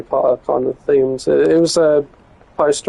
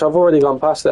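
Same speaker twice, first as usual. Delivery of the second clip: ordinary talk, nothing marked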